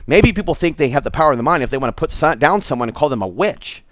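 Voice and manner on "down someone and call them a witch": ordinary delivery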